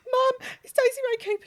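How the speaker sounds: high, loud, tearful squeal; squealing to mum. Retold or imitated. Fan shrieking to her mum about the follow. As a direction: call out to mum in disbelief.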